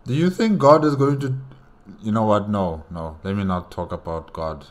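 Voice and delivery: ordinary talk, nothing marked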